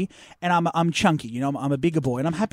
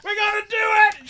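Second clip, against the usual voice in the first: high, urgent mock shout; hammed-up emergency holler; shouted warning; 12 semitones higher, 4 dB louder than usual. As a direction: imitate a nurse clearing the area. Betty shouting a warning before injection.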